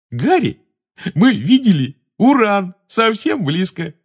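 high, loud, slow silly babble; giggling. A narrator's reading. Addled Ron boasts to Harry about Uranus, giggling. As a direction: brag through dazed giggles. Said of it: "всё ещё хихикая".

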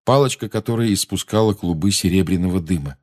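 ordinary delivery

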